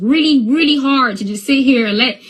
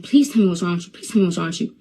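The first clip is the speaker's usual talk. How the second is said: lower, softer reenacted plea; retold begging; 6 semitones lower, 4 dB quieter, much faster than usual. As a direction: quietly plead as if begging a child. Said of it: "I'm begging him".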